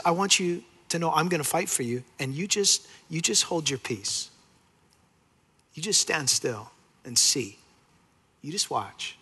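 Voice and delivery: put-on calm a worried child; gentle voice of God. God reassuring his anxious people, soft and tender.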